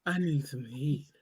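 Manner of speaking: low, soft, slow sultry purr; sexy auntie voice. Retold or imitated. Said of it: "this what she be doing".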